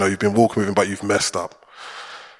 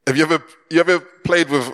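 ordinary delivery

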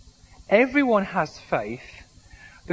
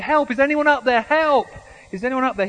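high, loud desperate calls; calling for help; 6 semitones higher, 5 dB louder than usual. Acted. Man clinging to a branch calls up the cliff. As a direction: shout upward hoping someone hears. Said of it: "He said".

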